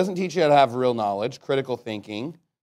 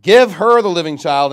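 firm, louder scripture reading; measured royal decree, loudly begun; king's ruling; 9 dB louder than usual. Acted. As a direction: pronounce the verdict with raised volume. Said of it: "Then the king answered and said".

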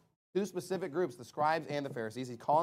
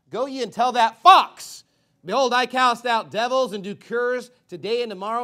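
loud, raised scripture voice; defiant proclamation; 5 semitones higher, 10 dB louder than usual. Acted. Preacher voicing Jesus's answer to threats from Herod, defiant. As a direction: hurl it out as a bold message.

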